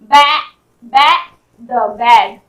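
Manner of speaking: "बैड" split into बै and द, then joined; sounding out. Shown as a demonstration. Teacher showing the sound of A, sounding out "बैड" piece by piece. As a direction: sound out "बैड" slowly with an open, punched ai. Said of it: "ए के रूप में".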